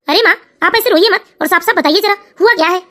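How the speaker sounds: a son gently pressing his mother; son's voice. Acted.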